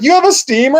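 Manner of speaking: imitating the daughter, amazed; hammed-up surprise, pitch leaping up; mock disbelief.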